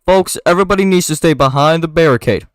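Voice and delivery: booming crowd-control order; policeman on megaphone. Acted. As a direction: call out to a crowd through a megaphone. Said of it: "a policeman enters through the megaphone".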